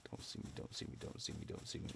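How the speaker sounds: hushed pleading whisper; whisper, sneaking past. Real, not acted.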